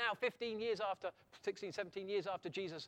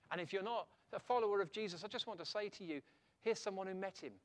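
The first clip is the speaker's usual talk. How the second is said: normal talking voice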